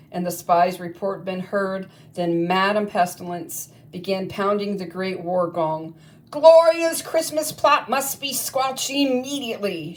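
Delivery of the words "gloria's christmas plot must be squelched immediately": high, loud bellow of a witch headmistress; a witch headmistress bellowing at her war council. Acted. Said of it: "she bellowed".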